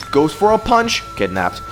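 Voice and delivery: normal talking voice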